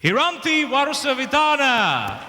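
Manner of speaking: rising announcer voice; pageant host. Real, not acted.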